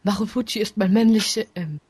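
ordinary talk, nothing marked

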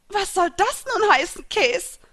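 high, loud, indignant outcry; zutiefst getroffen. A narrator's reading. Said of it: "fragte die Fischfrau zutiefst getroffen". (zutiefst getroffen, empört aufschreiend)

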